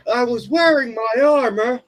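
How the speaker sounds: loud, very high mock protest; mock outraged self-defence; hammed-up indignant retort. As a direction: protest in an over-the-top high cartoon voice. Acted retort in retold pregnancy quarrel.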